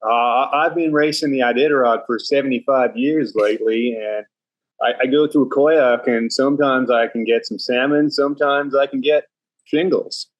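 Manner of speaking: Australian putting on an American accent. Shown as a demonstration. Guest on request spoofing an Iditarod veteran, in an American accent. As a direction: play an Alaskan old-timer in an American accent. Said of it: "how hard is it for you to do an American accent".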